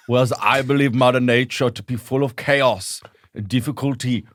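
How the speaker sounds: put-on solemn German-accented documentary narration; Werner Herzog. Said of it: "it's only Werner Herzog".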